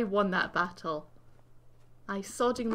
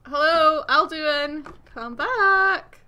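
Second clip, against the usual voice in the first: loud, raised holler into the distance; calling out; 5 semitones higher, 10 dB louder, much slower than usual. Real, not acted. Gamer calling the dragon Alduin back, hollering. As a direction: holler after someone who has gone.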